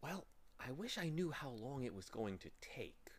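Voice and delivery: soft, half-whispered wish. A narrator's reading. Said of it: "Milo whispered".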